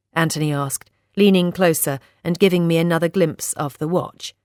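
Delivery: usual speaking voice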